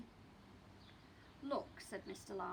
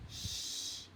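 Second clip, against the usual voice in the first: whispered shush. Acted. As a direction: hush them without waking Daddy. The baby hushes the others.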